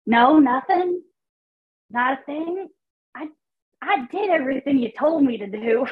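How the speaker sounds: high, aggrieved protest; annoyed complaint. Acted. Character shunned by God, increasingly annoyed. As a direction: complain upward to someone ignoring you. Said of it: "He's getting very, very annoyed".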